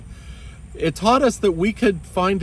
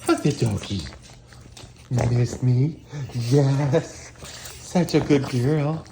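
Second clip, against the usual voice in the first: talking to a dog. Real, not acted. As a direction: coo over a good doggy. Talking baby talk to the dog.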